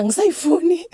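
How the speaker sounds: high, loud refusal; shouted refusal. Retold or imitated. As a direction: yell it, you're done with it. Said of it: "And I was serious".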